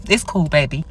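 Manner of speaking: soft, quiet sarcastic calm; a coldly calm it's cool baby; icy cool brush-off. Retold or imitated. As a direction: say it sweetly but mean the opposite. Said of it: "I said it just like this".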